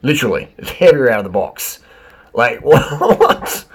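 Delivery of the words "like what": laughing disbelief. Real, not acted. Laughing at heavier bike.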